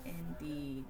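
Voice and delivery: ordinary delivery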